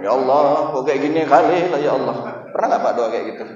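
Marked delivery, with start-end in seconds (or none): 0.0-2.1 mock complaining prayer. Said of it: "doanya sama Allah pun ngerepet".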